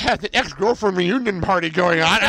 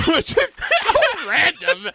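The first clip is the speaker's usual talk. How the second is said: laughing; 12 semitones higher, much slower than usual. Real, not acted. Laughing at random scene.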